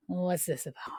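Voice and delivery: breathy puzzled whisper; hushed uneasy whisper. Retold or imitated. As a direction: whisper it to yourself, spooked. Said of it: "unvoiced whisper".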